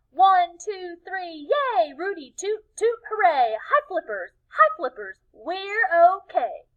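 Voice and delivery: high, loud rousing chant; Tacky leading everyone in a joyful cheer. Acted. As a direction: lead the whole crowd. Tacky leads crowd cheer.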